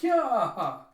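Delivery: loud, high yell, falling away; shouted outcry. Retold or imitated. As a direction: yell it out as a quoted reaction. Voices an excited outcry in recap.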